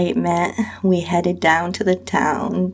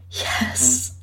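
soft breathy yes, grinning; whisper with a grin; 6 semitones lower, 3 dB quieter than usual. Acted. Answering her friend about the instructor, grinning whisper. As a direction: hush it with a smile. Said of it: "I whispered back with a grin".

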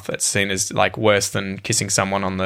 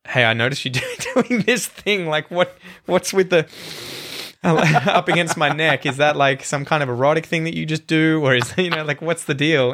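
higher-pitched, half-laughing puzzled query; bemused questioning; 5 semitones higher than usual. Retold or imitated. Husband quizzing his wife about nuzzling his neck, bemused and giggly. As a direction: ask it baffled and amused, breaking into giggles. Acts out confronting partner, laughing.

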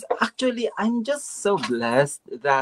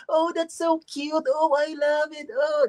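gushing mock delight; 12 semitones higher than usual. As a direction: coo over it like a thrilled American. Imitating gushing Americans.

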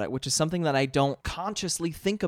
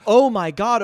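mock-surprised "oh my God", higher and louder; sudden realisation; 7 semitones higher, 10 dB louder than usual. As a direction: act out a loud, high dawning realisation. Voicing a local's sudden realisation.

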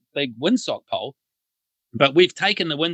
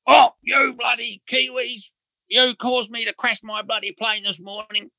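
mock shout blaming the Kiwis; hammed-up furious complaint, pitched up; retold angry rant; 8 semitones higher than usual. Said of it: "he bails the whole Kiwi team up".

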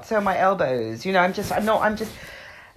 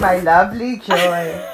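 slow, laughing echo of «My lovely joy»; laughing mock echo; 5 dB louder, slower than usual. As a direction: tenderly tease her words while laughing. Mock echo of friend's words.

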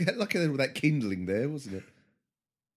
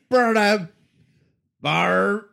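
louder mock mob shout; angry mob leader; 3 semitones higher, 7 dB louder, much slower than usual. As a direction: whip the crowd into fury. Mob chanting at witch burning.

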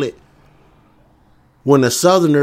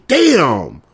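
ordinary delivery

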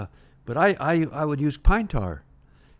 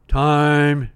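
loud, drawn-out call of time; umpire's call; 8 dB louder than usual. Retold or imitated. Umpire calling time on the field, loud and held. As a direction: call it out like an umpire stopping play.

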